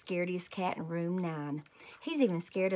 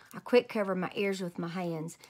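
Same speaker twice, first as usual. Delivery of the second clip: ordinary delivery, nothing marked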